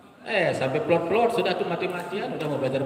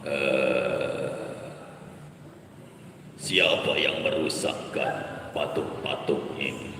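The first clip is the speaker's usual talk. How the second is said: deep, slow, menacing king's voice; angry king; 12 semitones lower, 4 dB quieter, much slower than usual. Shown as a demonstration. King Namrud demands who smashed the idols, deep and slow. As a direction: growl it out like a cruel king. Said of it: "suara ini kita pakai ngomong".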